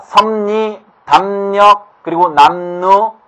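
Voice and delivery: 섭리, 담력, 남루 drawn out slowly as 섬니, 담녁, 남누; nasalization demo. Shown as a demonstration. Teacher gives students nasalization examples, slowly sounded out. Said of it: "비음이 아닌 것이 비음화됐죠".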